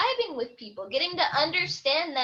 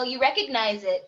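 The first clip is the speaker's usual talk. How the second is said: ordinary talk, nothing marked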